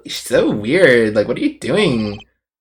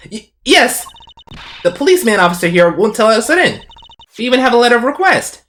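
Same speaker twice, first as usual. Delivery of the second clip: put-on high, plaintive girl character; eager young girl; 8 semitones higher than usual. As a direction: complain in a high girlish voice. Voices the girl in the game.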